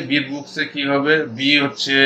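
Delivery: ordinary talk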